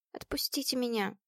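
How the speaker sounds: tearful, shaky near-whisper; trembling whisper. A narrator's reading. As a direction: plead softly through tears. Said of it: "прошептала она дрожащим от слёз голосом".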